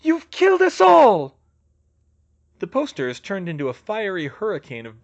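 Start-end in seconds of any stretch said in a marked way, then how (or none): 0.0-1.4 very high, loud horrified scream; panicked shriek. A narrator's reading. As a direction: scream the accusation in terror. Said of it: "she screamed".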